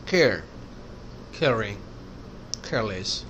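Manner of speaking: کیئر، کیئرنگ، کیئرلیس with a puff of h after the K; airy K opening کیئر; aspirated K. Shown as a demonstration. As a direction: breathe through the K of کیئرنگ. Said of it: "تھوڑا ایچ ہے اس میں".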